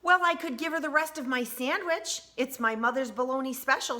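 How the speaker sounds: put-on lifted up high like a small boy; high child voice. A narrator's reading. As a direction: voice Josh as an eager little kid. Child voiced in read-aloud.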